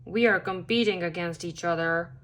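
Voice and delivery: low, quick character voice; brisk low grumbling reproach; grumpy toad scolding. A narrator's reading. Grumpy Todd scolds rival in story.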